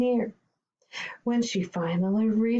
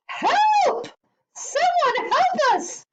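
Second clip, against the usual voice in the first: very high, loud cry for help; panicked wail; 6 dB louder, slower than usual. Acted. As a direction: wail like terrified little animals. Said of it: "wailed the animals".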